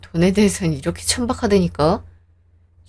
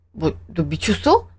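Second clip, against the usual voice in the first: stunned disbelief; 4 semitones lower, slower than usual. Acted. Husband hearing wife demand divorce, low stunned disbelief. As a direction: let the shock sink in.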